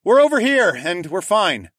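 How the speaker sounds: high, loud holler; calling out. A narrator's reading. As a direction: shout to rescuers across the clearing. Calling out to search party.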